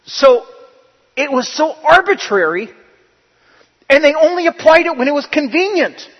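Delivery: loud, high, driving pulpit delivery; full-force preaching. Acted. Pastor hammering his point home to the church, preaching loud. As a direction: preach it hard and loud to the congregation.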